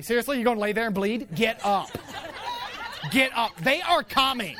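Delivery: loud, climbing battlefield commands; tough wife barking orders. Acted. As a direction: order a wounded soldier up, no sympathy. Wife as drill sergeant in war.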